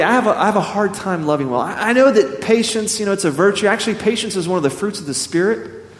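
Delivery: normal talking voice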